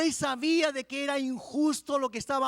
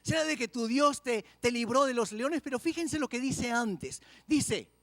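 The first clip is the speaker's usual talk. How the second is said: ordinary talk, nothing marked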